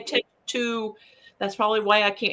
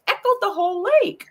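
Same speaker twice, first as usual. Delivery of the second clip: excited; 10 semitones higher, 3 dB louder, faster than usual. Retold or imitated. Shelley describes the loud crash.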